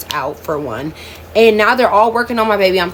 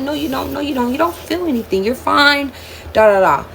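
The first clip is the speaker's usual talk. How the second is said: lightly mimicked, higher-pitched brush-off; dismissive nurse; 7 semitones higher than usual. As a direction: wave the patient off in a higher voice. Nurse brushes her off, retold.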